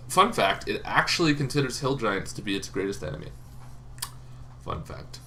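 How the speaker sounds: usual speaking voice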